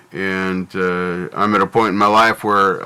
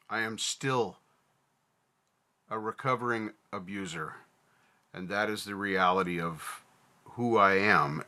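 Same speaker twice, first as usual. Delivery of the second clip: much softer, heavy and subdued; quiet confession; 13 dB quieter than usual. Real, not acted. Vlogger confesses to viewers he is a recovering abuser, hushed and heavy. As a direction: let the admission sink into near silence.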